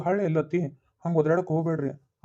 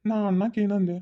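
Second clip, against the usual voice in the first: pleading protest; 5 semitones higher than usual. Acted. Son pleads with angry mother.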